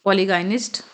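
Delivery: polygynist with a hard g, "poli-gainist"; mispronounced. Real, not acted. Teacher misreads a word from the list, mispronounced. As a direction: say polygynist with GAIN in the middle. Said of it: "ये मैंने word pronounce गलत किया था".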